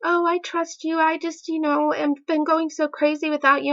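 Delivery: high-pitched mock pleading; imitated pleading boyfriend. Boyfriend reassures girlfriend on phone, high imitated pleading.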